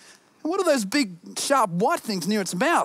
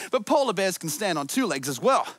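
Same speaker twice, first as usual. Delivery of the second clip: normal talking voice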